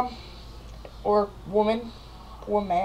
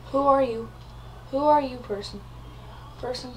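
normal talking voice

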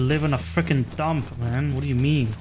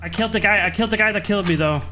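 excited; 6 semitones higher, 4 dB louder, faster than usual. Real, not acted. Revenge kill celebrated.

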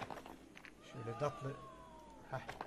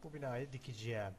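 usual speaking voice

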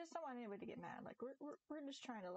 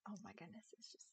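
whispered, softer delight; whispered gush; 6 dB quieter than usual. Real, not acted. Remembering holding the gecko, whispered gush. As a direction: breathe it out in awe.

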